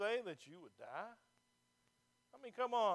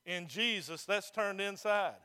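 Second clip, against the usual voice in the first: louder, slow, emphatic preaching; 8 dB louder than usual. Acted. Pastor warning the congregation about works, emphatic loud preaching. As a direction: hammer each word at the pews. Preacher pressing his point hard.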